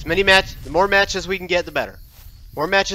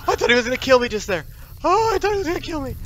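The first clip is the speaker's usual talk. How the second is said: higher-pitched, rattled relief; shaken relief; 9 semitones higher than usual. Real, not acted. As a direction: get it out after a close call. Shaken after near death in game.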